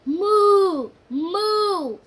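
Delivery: loud, high, drawn-out moo; a child's loud playful moo; cow mooing. A narrator's reading. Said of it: "said Cow".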